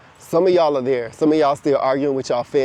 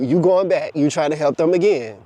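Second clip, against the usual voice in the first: usual speaking voice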